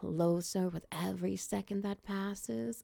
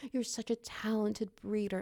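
ordinary delivery

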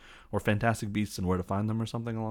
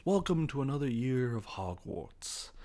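slow, higher put-on headmaster's welcome; Dumbledore; 5 semitones higher than usual. Headmaster welcoming students to Hogwarts, slow put-on voice. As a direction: welcome the school slowly and grandly. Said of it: "he basically goes".

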